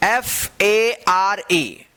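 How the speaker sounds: each letter drawn out slowly; slow spelling out. Shown as a demonstration. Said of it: "इसको क्या पढ़ेंगे?". (अक्षर-अक्षर धीमे से बोलना)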